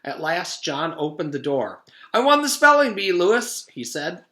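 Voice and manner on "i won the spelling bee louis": high, loud gleeful crowing; proud boast. Acted. John boasting to Louis, high and loud. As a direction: brag about winning. Boy boasting after spelling bee.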